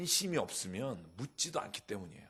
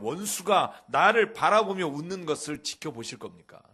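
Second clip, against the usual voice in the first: louder and louder, pleading with God; impassioned lament reading; 3 semitones higher, 7 dB louder than usual. Acted. Preacher reading Psalm 13 to the congregation, rising to a loud plea. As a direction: build the lament until it cries out.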